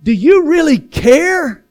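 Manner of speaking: loud, high, slow challenge to God; pleading question. Acted. Believer questions God in hard times, loud anguished plea. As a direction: cry it upward, drawing out each phrase.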